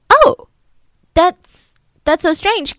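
high, loud startled "oh"; awkward mock surprise. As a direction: act caught off guard. Stranger reacts awkwardly.

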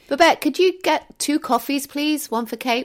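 high, loud call across the room. Acted. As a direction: summon the assistant loudly. Calling out to assistant.

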